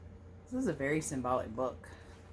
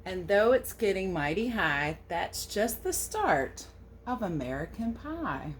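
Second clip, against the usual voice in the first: storybook read-aloud; 3 semitones higher, 5 dB louder than usual. A narrator's reading. Reading the American Pie book aloud to children, storybook lilt. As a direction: read the verse a little louder, with a gentle lilt. Reads the picture book text.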